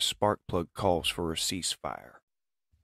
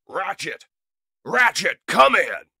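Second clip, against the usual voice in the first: urgent radio call; 11 dB louder than usual. Acted. Calling over the radio.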